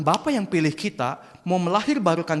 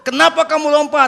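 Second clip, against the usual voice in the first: loud, high-pitched demand; scolding father; 10 semitones higher, 10 dB louder than usual. Acted. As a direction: shout it as an alarmed dad. Father scolds dangling son.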